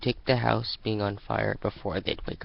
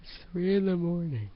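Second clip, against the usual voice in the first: a higher, weary inner voice; lightly voiced thoughts; 8 semitones higher than usual. Sunset thinking at three in the morning, mildly put out. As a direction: think it, half asleep and put out.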